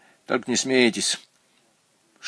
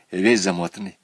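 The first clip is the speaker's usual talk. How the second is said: usual speaking voice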